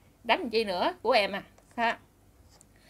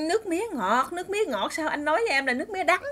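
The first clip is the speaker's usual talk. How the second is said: lightly voiced small child, higher pitched; little boy; 6 semitones higher than usual. Little boy asking brother why he lied, high child voice. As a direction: play a puzzled kid questioning big brother. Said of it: "cái thằng nhỏ nó nói".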